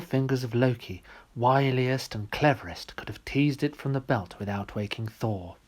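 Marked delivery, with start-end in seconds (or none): none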